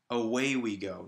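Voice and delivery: "Away" with the weight on WAY; a light A, then lean on the "way" of "Away"; stress on second syllable. Shown as a demonstration. Teacher repeating the right rhythm, "Away" stressed on way. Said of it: "That the stressed syllable is on way".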